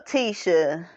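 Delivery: usual speaking voice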